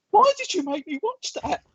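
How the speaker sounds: high, aggrieved complaint; high mock-indignant whine to partner; outraged whine. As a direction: wail at her for making you watch. Retold complaint to partner after film.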